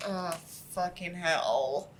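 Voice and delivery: ordinary delivery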